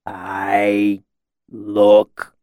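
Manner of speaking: drawn-out words with a long gap; halting, thick delivery; slow, dazed speech. A narrator's reading. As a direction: drag it out as if befuddled. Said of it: "he said slowly".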